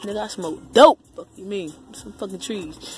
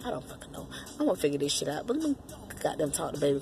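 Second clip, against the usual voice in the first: ordinary talk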